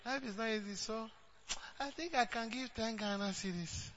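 softly mimicking a hesitant excuse maker; mock stingy member. Mocking a church member who offers only ten cedis, hushed. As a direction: play the reluctant giver, hushed and hedging. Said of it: "You will say that".

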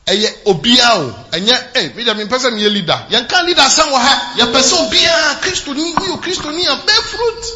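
loud, high fervent Twi preaching; preaching at full force. Acted. Pastor exhorting each member to bring people to Christ, loud. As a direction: fire them up in Twi.

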